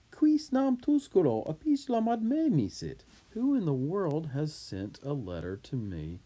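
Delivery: high, loud, indignant-sounding astonishment; emphatic disbelief. Acted. Julius asking who sent the letter, emphatic disbelief. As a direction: demand an answer, incredulous. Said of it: "it just adds more emphasis".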